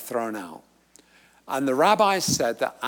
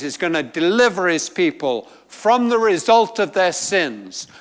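louder, higher, driving sermon voice; fervent preaching; 5 semitones higher, 7 dB louder than usual. Acted. Preacher to congregation on Israel's deliverance, fervent and loud. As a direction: preach it with rising fervour.

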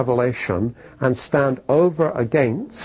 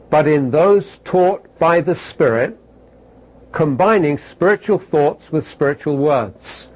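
raised, slow scripture reading; slow, lifted reading of the verse; 3 semitones higher than usual. Shown as a demonstration. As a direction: read the verse out with weight. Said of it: "Chapter two and verse thirteen".

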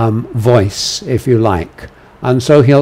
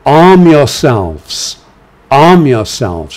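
slow, louder rallying order; rally the church to arms; commanding voice; 2 semitones higher, 5 dB louder, slower than usual. Shown as a demonstration. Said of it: "imperative, um, voice".

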